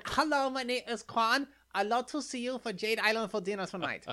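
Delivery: imitating Quan. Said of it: "That's how she sounded".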